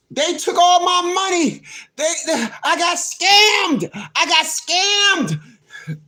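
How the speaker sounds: high, drawn-out fake bawling; hammed-up scam victim's wail; mock wailing. Imitating a scam victim in a TV video, mock wail.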